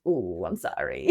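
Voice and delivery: low, soft cringing sorry; awkward mock apology. Heroine dodging his love confession, low awkward apology. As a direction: drop low and wince out the apology.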